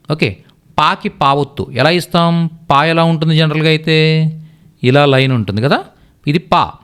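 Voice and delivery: usual speaking voice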